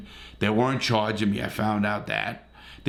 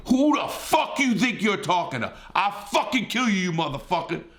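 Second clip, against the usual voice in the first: much higher, furious retold threat; retold blowup; 9 semitones higher than usual. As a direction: relive snapping at the guy, voice up high. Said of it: "I fucking snapped".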